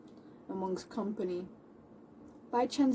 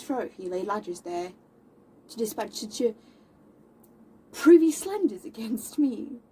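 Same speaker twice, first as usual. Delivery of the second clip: Moll's indignation; 2 semitones higher, 7 dB louder than usual. Acted. Moll confronting her slanderer to his face, indignant and loud. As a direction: confront him in declamatory bursts. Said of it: "her describing how she feels about".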